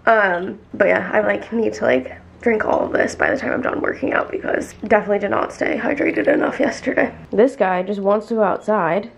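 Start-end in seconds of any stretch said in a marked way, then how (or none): none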